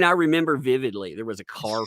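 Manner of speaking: ordinary delivery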